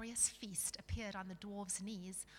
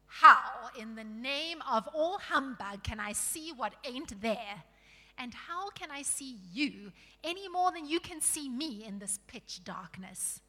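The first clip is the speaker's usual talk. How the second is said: loud, indignant dwarf; surly dwarf; 2 semitones higher, 9 dB louder than usual. Acted. Surly dwarf insisting he sees only darkness, exasperated. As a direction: argue back loudly and crossly.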